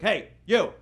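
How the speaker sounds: loud, high two-beat call; father calling out. Retold or imitated. Dad pointing at his son at Christmas, loud summoning shout. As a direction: summon him sharply from across the room.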